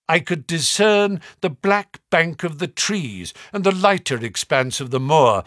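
usual speaking voice

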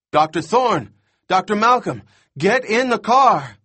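high, loud frantic calls; hysterical shouting. A narrator's reading. Boy on radio warns the doctors, hysterical shout. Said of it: "He sounds completely hysterical".

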